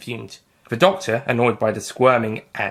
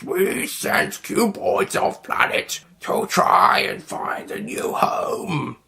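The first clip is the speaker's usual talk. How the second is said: slow, high whispery creature; alien creature voice; 9 semitones higher, much slower than usual. Acted. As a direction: hiss the words like a squid alien. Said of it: "a squid Nestine said".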